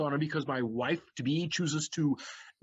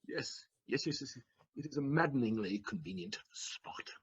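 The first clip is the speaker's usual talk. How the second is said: whispered, much softer bitter realization; 4 semitones lower, 6 dB quieter than usual. Acted. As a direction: seethe it under your breath. Figaro muttering bitterly to himself.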